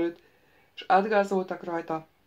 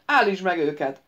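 commanding, louder order; 7 dB louder than usual. Acted. Xera orders the wizard to stop the soldiers: loud command. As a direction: bark the order to halt them. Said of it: "parancsoltak Xera".

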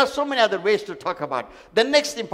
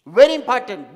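much louder, punched; sudden loud emphasis; 4 semitones higher, 10 dB louder than usual. Acted. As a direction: hit it hard for emphasis. Preacher stressing point.

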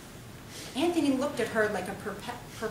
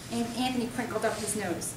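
usual speaking voice

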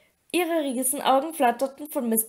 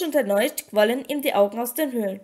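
ordinary delivery

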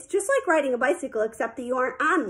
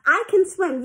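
higher, indignant insistence; insistent; 6 semitones higher, 4 dB louder than usual. A narrator's reading. Girl defending herself to her swim coach, insistent. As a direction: protest it firmly, pitch lifted.

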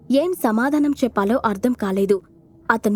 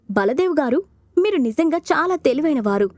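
ordinary delivery

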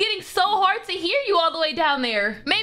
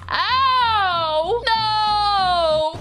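pained cry; 3 semitones higher, 3 dB louder than usual. Acted. Hurt cry after fall.

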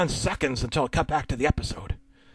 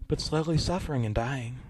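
plain reading voice

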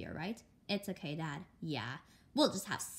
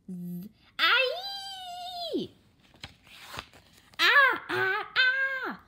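much higher, loud cries of pain; pained scream; 8 dB louder, much slower than usual. Acted. Girl waking in the mountains with aching ears, screaming. Child wakes in ear pain.

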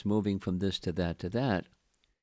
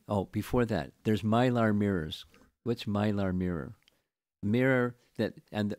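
ordinary talk, nothing marked

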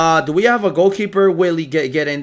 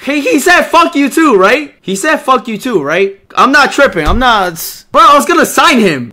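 loud, high, incredulous ranting; can't believe what he just heard; outraged disbelief; 6 semitones higher, 7 dB louder than usual. Real, not acted. Streamer ranting to chat about the insult in loud, high disbelief.

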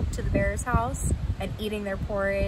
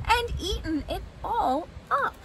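much higher little bear voice; high-pitched cub's complaint; Baby Bear; 10 semitones higher than usual. Acted. Baby Bear finds his bowl emptied, high little bear voice. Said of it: "said Baby Bear".

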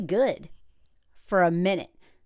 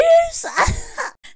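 real, squealing laugh; high burst of laughter; 6 dB louder than usual. Real, not acted. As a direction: crack up high and loud. Laughing at the jet ski line.

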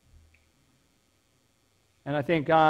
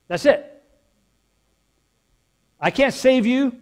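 higher, louder preacher's proclamation; preaching at full force; 6 semitones higher, 7 dB louder than usual. Acted. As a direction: drive the point home to the congregation, slowing on key phrases.

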